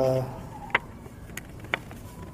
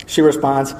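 ordinary talk

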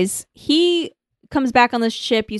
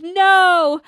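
mock solemn; 7 semitones higher, much slower than usual. Voicing Kai's solemn line.

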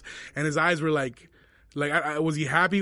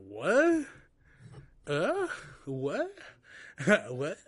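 stunned rising what, jumping high; retelling being told to pay and leave, stunned high rising what; incredulous what; 4 semitones higher, much slower than usual. Retold or imitated.

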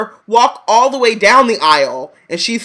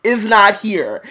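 louder, higher sassy rant; mock snarl; 5 semitones higher, 4 dB louder than usual. Parodying Harry snapping at Hermione in the aisle, sassy rant. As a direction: tell her off, nobody's here.

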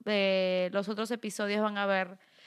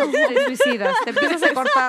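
louder, higher, through laughter; mock-dramatic line broken by laughs; laughing; 13 semitones higher, 10 dB louder than usual. Real, not acted. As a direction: joke it out while cracking up. Joking about the notebook.

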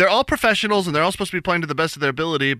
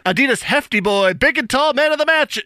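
hyped-up, higher sponsor-read voice; ad announcer; 7 semitones higher than usual. Acted. Radio host announcing the sponsored man of the match. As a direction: sell the award like a radio ad spot. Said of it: "repeats the sponsor read".

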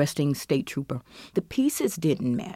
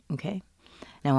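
ordinary talk, nothing marked

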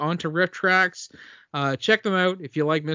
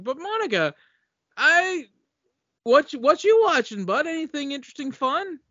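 playful high voice; 12 semitones higher, 4 dB louder than usual. Acted. Host playfully asking the guest.

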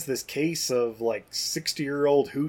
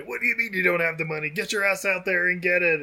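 higher-pitched, fast mock berating; angry boss; 4 semitones higher than usual. Co-host voicing gang boss demanding money, mock angry. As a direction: rattle off a scolding as the boss.

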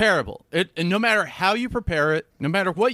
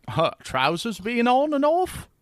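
mock British; 8 semitones higher, slower than usual. British bit.

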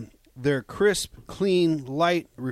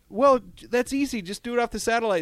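high mock know-it-all voice; 10 semitones higher than usual. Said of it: "they'd say".

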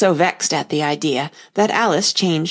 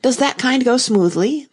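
eager interest; 6 semitones higher, 4 dB louder than usual. A narrator's reading. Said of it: "in a tone of great interest".

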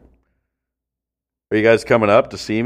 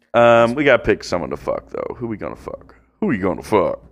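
ordinary delivery

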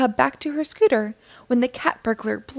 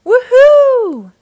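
very high, loud, drawn-out whoop; cheering; 14 semitones higher, 12 dB louder than usual. A narrator's reading. Guppies celebrating the unveiling, loud high cheer. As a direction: cheer at full excitement. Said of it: "cheered the Bubble Guppies".

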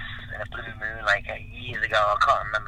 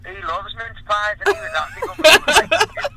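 building excitement; 10 semitones higher, 9 dB louder than usual. Real, not acted.

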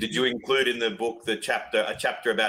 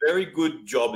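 normal talking voice

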